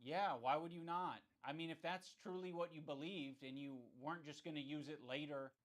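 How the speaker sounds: ordinary talk, nothing marked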